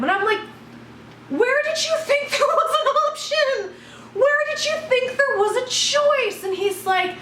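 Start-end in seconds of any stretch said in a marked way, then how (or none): 1.3-6.4 very high, indignant challenge; retold fury, voice pitched way up; incredulous outrage. Retold quarrel over choosing.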